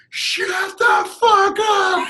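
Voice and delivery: high, loud laughing yell; imitated rage. Angry fan turning on the heckler, high mock yell. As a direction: scream it like an angry fan losing it. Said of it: "he's like".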